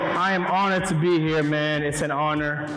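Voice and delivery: ordinary delivery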